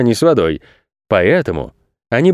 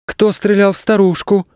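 high, startled gasp of a question; gasping in alarm; 8 semitones higher than usual. Acted. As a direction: cry it out in shock. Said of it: "ахнули Саша и Паша". (испуганно ахнуть)

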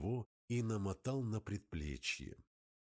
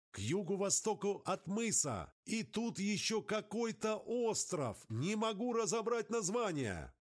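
distant radio voice; 11 semitones higher than usual. Acted. Radio voice through static.

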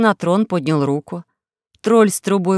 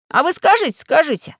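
put-on insistent boy; 9 semitones higher than usual. Pashka refusing to give up, high insistent call. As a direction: keep pushing, voice up. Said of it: "не сдавался Пашка".

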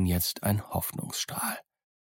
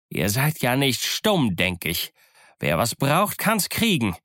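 emphatic challenge, swinging in pitch; Silver, defiant pirate leader; 3 semitones higher than usual. Acted. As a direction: dare the crew, stretching key phrases. Silver challenges his crew.

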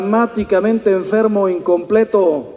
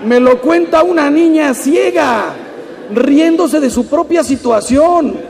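raised, louder amazement; excited wonder; 6 semitones higher, 4 dB louder than usual. Real, not acted. Moved exclamation. (asombro emocionado)

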